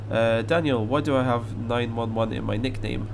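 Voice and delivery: ordinary talk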